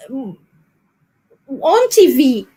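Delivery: usual speaking voice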